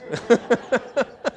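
breaks into a high laugh at his own joke. Real, not acted. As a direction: genuine burst of laughter. Cracks himself up mid-sermon.

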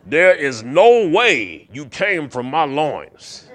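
slow, loud mock Southern sheriff; Buford T. Justice. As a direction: growl it out like a disgusted lawman. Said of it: "like, the voice of Buford T. Justice".

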